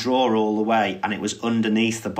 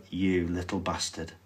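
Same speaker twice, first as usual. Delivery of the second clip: low, much softer deadpan retort; stone-cold; 4 semitones lower, 9 dB quieter than usual. Retold or imitated. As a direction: say it coldly under your breath. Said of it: "stone cold look at me".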